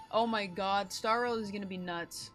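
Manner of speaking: usual speaking voice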